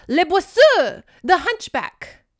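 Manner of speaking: high, loud villain announcement; melodramatic, drawn-out unveiling; dramatic reveal. Acted. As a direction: announce the monster with a flourish.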